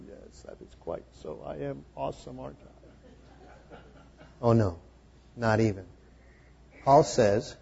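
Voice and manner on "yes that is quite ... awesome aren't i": whispered, haughty self-praise; smug whisper. Retold or imitated. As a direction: whisper it with lofty smugness. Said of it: "you stand there going".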